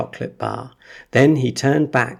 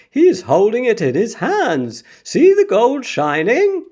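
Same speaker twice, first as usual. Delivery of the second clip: shouting; 12 semitones higher, 6 dB louder than usual. A narrator's reading. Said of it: "someone else shouted".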